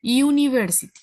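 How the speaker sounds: UNIVERSITY with an opening "yu" glide; correct English pronunciation. Shown as a demonstration. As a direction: model UNIVERSITY slightly emphatically, starting like a Y. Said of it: "sí, Y U I". (UNIVERSITY pronunciada con "yu" inicial, como consonante)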